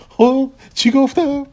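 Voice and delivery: high, loud, drawn-out crowing; triumphant. Acted. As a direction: crow it slowly, very pleased with yourself. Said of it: "پرسکی فاتحانه گفت".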